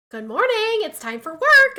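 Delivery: bright, loud sing-song good morning; cheery husband wake-up. Retold or imitated. Wife imitating her cheerful husband waking her, loud and chirpy. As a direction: chirp it like a sunny morning person. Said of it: "he comes in and he goes".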